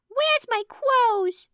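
put-on high squeaky toddler yelling; yelling kid voice. A narrator's reading. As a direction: shout it like a little kid. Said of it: "the kids yelled".

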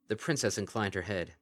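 usual speaking voice

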